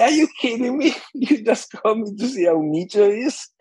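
high, laughing through the words; half-laughing incredulous protest; laughing disbelief. Retold or imitated. Retelling the friend's phone call, amused laughing disbelief.

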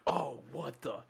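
stunned surprise. Retold or imitated. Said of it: "You're just like".

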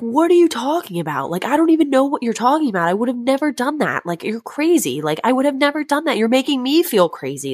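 high-pitched, rushed retold protest; indignant denial. Accused man defends himself at the reunion, imitated indignant protest. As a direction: imitate him protesting in disbelief.

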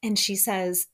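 ordinary delivery

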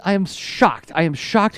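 usual speaking voice

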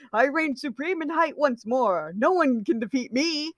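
high, grandiose proclamation; triumphant boasting. A narrator's reading. Roman gloats over his height to Virgil, triumphant and high. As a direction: proclaim victory like a pompous champion. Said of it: "smiling triumphantly".

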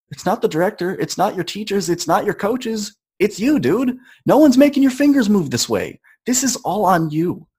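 pep talk. Retold or imitated. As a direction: fire yourself up.

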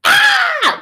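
sudden very high, loud shriek of frustration; frustrated shriek. Retold or imitated. Imitating daughter wanting to rip the paper, shriek. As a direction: let out a kid's exasperated squeal. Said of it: "like my daughter".

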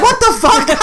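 high, laughing outburst of disbelief; shocked disbelief. Retold or imitated. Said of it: "I was like".

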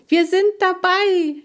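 high, loud cheer; jubilant. A narrator's reading. Girl cheers after reading the letter, jubilant. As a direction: shout it out in triumph. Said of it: "jubelte sie".

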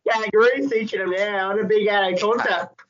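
high, silly mock-Aussie voice; Australian accent. Mocking a teacher turned contestant, high Australian accent. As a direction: ham up an Australian through giggles. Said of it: "sorry for any Australians".

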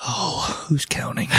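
unvoiced whisper, wry and dry; whispered aside. Real, not acted. Dry aside about banners.

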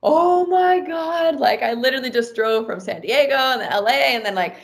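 stretched, high-pitched overwhelmed outburst; retold tearful disbelief. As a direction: act out being overwhelmed, without real tears. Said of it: "started crying, like, right on the spot".

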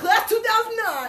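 high, loud imitation of dad hollering; yelled out the window. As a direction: shout it proudly for the whole street. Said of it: "he yelled out the window".

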